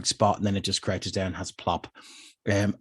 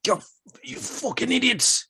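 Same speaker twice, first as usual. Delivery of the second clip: angry guard; 13 semitones higher than usual. Acted. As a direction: yell it, furious and high. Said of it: "you can hear from from further back".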